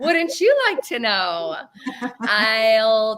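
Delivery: slow, flirty, drawn-out tease; string them along playfully; coy tease. Pretend. Coy reply to ring question.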